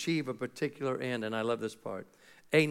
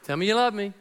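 needy spouse; 4 semitones higher, faster than usual. Retold or imitated. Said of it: "That happens between married people".

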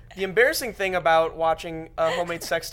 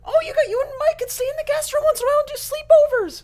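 very high sing-song voice; put-on voice; 5 dB louder than usual. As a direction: coo like a sweet hostess. Put-on voice offering sleepovers.

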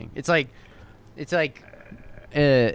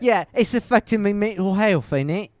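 higher-pitched mock British accent; fake British accent; 7 semitones higher than usual. Reading an article quote to co-hosts, mock British accent. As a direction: put on a comic British accent. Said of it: "adopting fake British accents".

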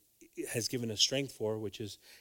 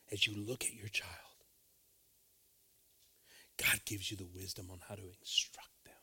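soft, hushed pastoral words; whispered preaching; 3 semitones lower, 5 dB quieter than usual. Acted. Pastor whispering to parents in the church, hushed. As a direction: draw them in with a hush.

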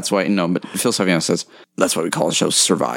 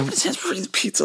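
mocking high voice; 13 semitones higher, 5 dB quieter, much faster than usual. Mocking Ruffalo's hand rubbing.

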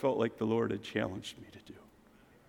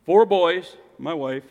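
normal talking voice

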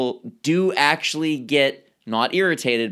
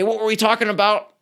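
distracted question; 7 semitones higher, 3 dB louder, faster than usual. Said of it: "look back at the person and say".

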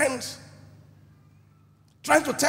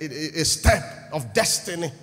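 normal talking voice

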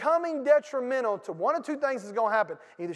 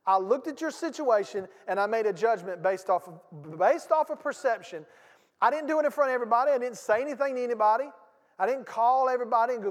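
louder, higher, fast pulpit drive; full-force preaching; 3 semitones higher, 5 dB louder than usual. Acted. Pastor pressing his congregation, full-force preaching. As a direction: push the point hard from the pulpit.

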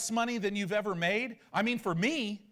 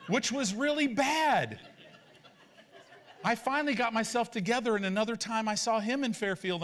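ordinary delivery

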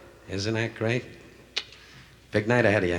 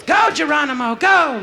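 high, loud mock cheer; wife's cheer; 13 semitones higher, 4 dB louder, slower than usual. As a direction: shout it up high like a cheering wife.

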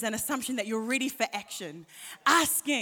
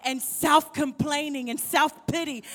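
high, loud climactic preaching; impassioned preaching; 4 semitones higher, 7 dB louder than usual. Real, not acted. Preacher urging the church to leave offense, loud and high. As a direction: pour it out at full force.

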